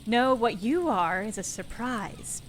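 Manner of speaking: slow, loud, weighty line; slow deliberate. Acted. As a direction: drag it out slowly. Character line read out slowly.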